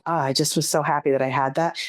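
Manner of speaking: normal talking voice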